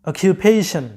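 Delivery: অকুপেশন as ok-yu-PAY-shun, with y-glide; correct pronunciation. Shown as a demonstration. Teacher corrects students' word, correct pronunciation. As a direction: model অকুপেশন English-style with "kyu", falling. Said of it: "সঠিক উচ্চারণটা হচ্ছে".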